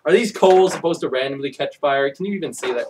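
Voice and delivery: ordinary delivery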